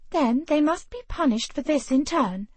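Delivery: a hint of an outraged heroine; indignant young woman. A narrator's reading. Ravenna voiced higher.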